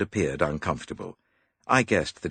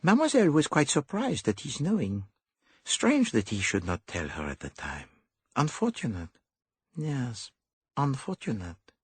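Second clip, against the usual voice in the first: higher, fussy little detective; higher dapper detective; Poirot voice; 10 semitones higher than usual. A narrator's reading. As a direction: play the clever Belgian. Poirot musing.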